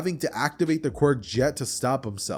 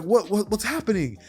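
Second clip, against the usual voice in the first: higher-pitched startled questioning; bewildered; 8 semitones higher than usual. Acted. Mother walks in on the clobbering, bewildered. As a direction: stammer it out, alarmed and confused. Acted startled reaction to commotion.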